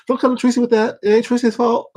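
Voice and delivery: high, loud mock rant in Tracy's voice; imitating Tracy. Imitating Tracy ranting at the chat, high and loud. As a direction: squeal it out like Tracy going off. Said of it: "she be like".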